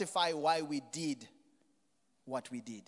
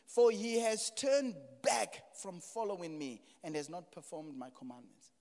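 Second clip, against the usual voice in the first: raised, loud pulpit delivery; impassioned preacher retelling scripture; full-force preaching; 7 semitones higher, 9 dB louder than usual. Acted. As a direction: preach it loud and high, acting out the exchange.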